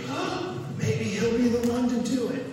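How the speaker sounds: high, hopeful oh from the crowd; hopeful gasp. Retold or imitated. Preacher voicing the congregation's high hopeful gasp. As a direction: gasp with sudden hope, voice jumping up. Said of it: "all of us are going".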